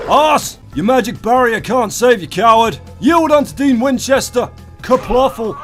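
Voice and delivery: high, loud gloating warlord; villain battle cry. Acted. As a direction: bellow the challenge like a conquering warlord. Fighting a shielded enemy as Dean.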